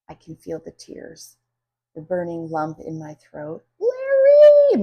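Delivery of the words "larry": very high, loud scream of a name; long high loud shriek. A narrator's reading. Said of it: "Missus Green screams again".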